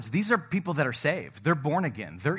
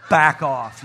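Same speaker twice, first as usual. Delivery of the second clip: louder, abrupt order to back away; lightly acted firm usher, raised voice; mock usher's warning; 7 dB louder than usual. Usher shooing some dudes off, mock stern warning.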